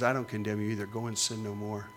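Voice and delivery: very soft, gentle forgiveness; Jesus quietly forgiving the woman; tender Jesus. Retold or imitated. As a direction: hush it down to a kind release. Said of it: "And he says".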